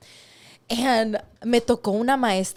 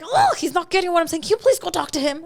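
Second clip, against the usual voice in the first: higher, louder, quick frustrated teacher; 8 semitones higher, 5 dB louder, faster than usual. Retold or imitated. Quoting the frustrated teacher asking for help, mildly exasperated. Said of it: "she would get frustrated".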